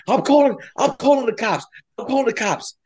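high-pitched agitated warning; heated threat. Retold or imitated. Driver threatens cyclist with police, high agitated yelling. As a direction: retell a frantic threat, voice way up.

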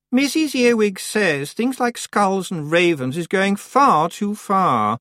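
high, slow, superior witch; snooty girl voice. A narrator's reading. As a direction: lecture them primly.

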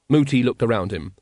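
usual speaking voice

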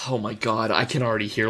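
ordinary talk

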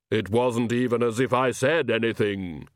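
hollow, otherworldly Death; lightly voiced grim reaper; Death's voice. A narrator's reading. Death speaks.

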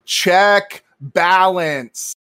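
loud, very slow robotic command; automated phone voice. Shown as a demonstration. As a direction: enunciate like talking to a phone menu. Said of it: "I'm gonna say it like, like you do in the phone, those automated".

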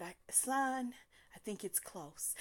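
mock feeble whisper of a mother on her deathbed; faint dying mother. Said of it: "her half-dead eyes".

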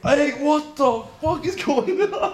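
very high, drawn-out mock panic; hammed-up panicked squeal; freaking out. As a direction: imitate friends in full freak-out. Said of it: "We're, like, freaking out".

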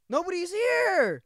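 high, loud, drawn-out protest; exasperated yell. Retold or imitated. Employee to manager before the store opens, exasperated yell. Retold outburst at nagging manager.

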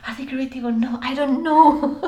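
high, building to a loud laughing cry; mock bewilderment. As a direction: throw it out in comic despair. Acts out her bewildered questioning.

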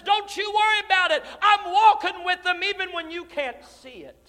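high, loud preaching shout; loud, high, driving preaching; preaching at full force. Acted. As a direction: thunder God's answer with full fire. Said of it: "And God says".

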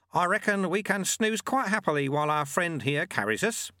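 put-on high-pitched, untroubled old monk; Lutze, easygoing monk.

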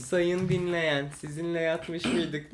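slow, grand address to the audience; mock announcer. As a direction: drawl it like a pompous host.

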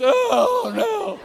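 high, loud wail of dread; hammed-up oh-no of a caught driver; dismayed wail. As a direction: moan it out in mock despair. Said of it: "feel like beating your head against".

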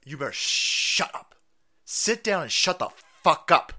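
mock scolding. Voicing MSNBC telling viewers off.

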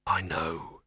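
low, grim acknowledgement. A narrator's reading. Man cutting off his companion's warning, grim. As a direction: cut in grimly, voice dropped low. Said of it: "said Victor grimly".

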